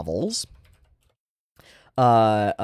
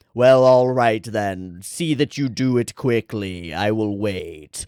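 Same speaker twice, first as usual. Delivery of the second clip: Death's voice; 2 semitones lower, slower than usual. Retold or imitated. Death appearing to Angela, slow and grave. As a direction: announce her doom slowly like the Grim Reaper. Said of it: "that's the voice that he uses".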